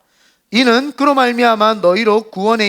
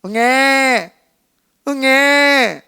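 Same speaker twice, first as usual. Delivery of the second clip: mock baby wail with gaps between cries; spaced hungry baby cry; 4 semitones higher, 4 dB louder, much slower than usual. Preacher imitating a hungry baby's cry for the congregation. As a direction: cry "응애" slowly, pausing between each. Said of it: "우는 것이 사이를 두고 울어요".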